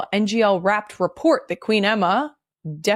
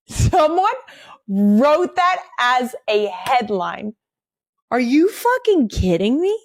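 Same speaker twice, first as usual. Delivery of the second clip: mock outrage; 5 semitones higher than usual. Real, not acted. Outraged at dumb headline.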